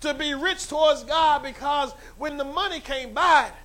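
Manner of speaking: high, loud preacher's cry; full-force preaching. Acted. Pastor crying out to God before the church, loud preaching. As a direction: lift the church with each line.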